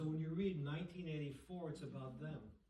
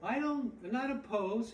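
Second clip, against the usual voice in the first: raised, loud imitation British accent; mock British accent; 7 semitones higher, 9 dB louder than usual. Quoting Shaw to an audience, mock British accent. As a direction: loosely imitate a British speaker, pitched up and loud. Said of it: "he says in his British accent".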